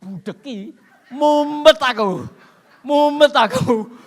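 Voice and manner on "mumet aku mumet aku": much louder, higher, slow groaning complaint; preacher showing how the noise drove him crazy, loud and slow; dizzy complaint. Acted. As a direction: shout out the dizziness dramatically. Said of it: "Lah saya kalau ndak tak budeki".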